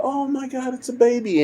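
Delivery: high, soft mock gasp of wonder; awed gushing. Ma Kent to her husband on finding a baby, awed gushing. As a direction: imitate a delighted woman in a high, soft voice.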